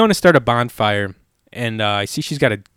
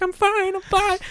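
much higher, squeaky mock of a dismissive lady; mimicking the woman; 4 dB quieter than usual. Woman on fire waving off the stranger, high mock voice. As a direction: play her brushing it off in a tiny high voice.

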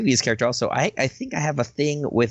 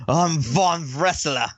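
put-on slow, hammy Russian-accented wrestler; 4 semitones higher, slower than usual. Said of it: "Just the Russian accent".

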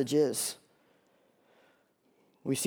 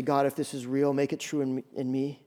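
soft, hushed plea to God; gentle prayer; 3 semitones lower, 6 dB quieter than usual. Acted. Preacher modelling an honest prayer for the congregation, softly. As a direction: pray it quietly and humbly.

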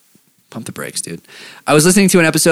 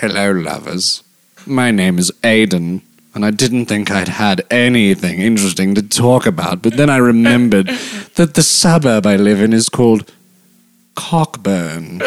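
Snape; 6 semitones lower than usual. Acted. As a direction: read it deep and deliberate, lingering on each phrase. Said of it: "like, a Snape voice".

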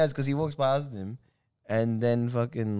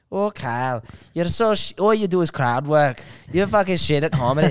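loud mock-Scouse put-down; Scouse argument; 3 semitones higher, 5 dB louder than usual. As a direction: imitate a Liverpool accent, higher and louder. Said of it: "doing this Scouse argument".

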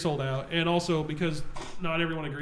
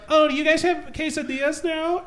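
high-pitched, drawn-out customer voice; high, slow mock customer, accented "quesadillas"; lady customer with an accent; 9 semitones higher than usual. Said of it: "did she say the word quesadilla with an accent".

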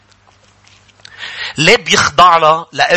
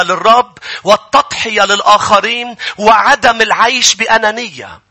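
high, emphatic sermon delivery; fervent preaching; 9 semitones higher, 4 dB louder than usual. Acted. Preacher explaining love to the people of God, fervent. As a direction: preach it out with a raised, driving voice.

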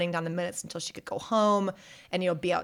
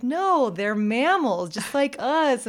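put-on insistent child; 6 semitones higher, 4 dB louder, slower than usual. Jane correcting aunt.

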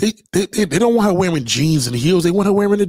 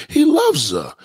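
ordinary talk

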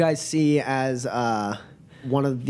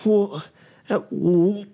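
put-on drunk; 5 semitones higher than usual.

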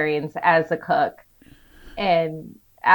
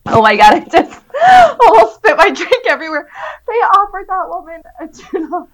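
high, loud laughter through words; crying with laughter; laughing hard; 10 semitones higher, 10 dB louder than usual. Real, not acted. Cracking up at refund.

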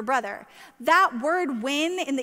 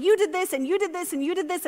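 jab each "you" like an accusation; angry accusation; 6 semitones higher, faster than usual. Retold or imitated. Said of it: "I was calling names".

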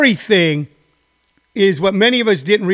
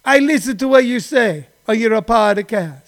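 friend Giuseppe in a hammed-up Italian-American accent, louder; mock Italian friend; 2 semitones higher, 4 dB louder than usual. Friend Giuseppe asks Tony why he talks like that, hammed-up Italian accent. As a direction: scold Tony for faking the accent. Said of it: "and he say".

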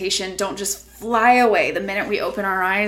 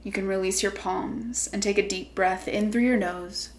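guided meditation; 5 dB quieter than usual. Acted. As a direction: gently guide them inward.

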